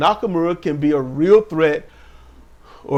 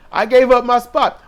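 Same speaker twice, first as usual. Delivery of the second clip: mock indignant complaint; 7 semitones higher, 5 dB louder than usual. Acted argument between wrestlers.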